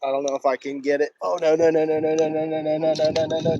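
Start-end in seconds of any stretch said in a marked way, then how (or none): 1.2-3.6 loud, rapid string of no; panicked no. Real, not acted. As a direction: plead as it all goes wrong. Phone strap slipping, fish running.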